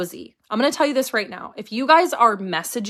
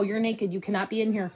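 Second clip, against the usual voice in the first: hushed scolding; 7 dB quieter than usual. Real, not acted. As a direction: scold quietly so the camera misses it. Scolding child under her breath.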